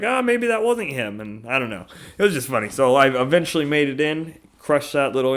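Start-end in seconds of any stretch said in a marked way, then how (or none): 0.0-1.2 much higher, musing; mock manager thought. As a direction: voice her puzzlement. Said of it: "So I was like".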